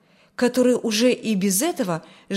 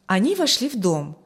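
ordinary delivery, nothing marked

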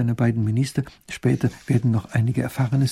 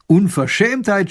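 indignant scolding, louder and higher; 8 semitones higher, 6 dB louder, slower than usual. Acted. Baron scolding the captain, indignant outburst. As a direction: spit out the outrage. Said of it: "schimpft Raffin".

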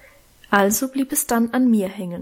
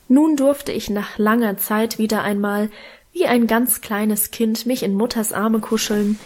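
plain reading voice

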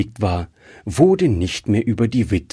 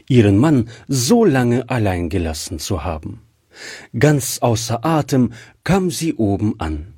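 ordinary delivery

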